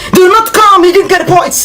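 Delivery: high, insistent exasperated plea. Real, not acted. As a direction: press the point in a high, worked-up voice. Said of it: "I no shout for you".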